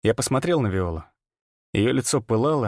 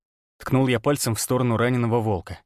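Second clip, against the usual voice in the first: ordinary delivery, nothing marked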